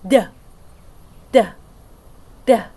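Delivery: short voiced "Duh" taps, tongue at the teeth; letter D sound. Shown as a demonstration. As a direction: tap out "Duh" with voice on. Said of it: "Can you do it with me?".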